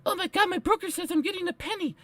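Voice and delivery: panicky investor. Retold or imitated. Mimicking a panicked retail investor, high and fast. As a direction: squeak it out in a rush. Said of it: "it was like the penny thing".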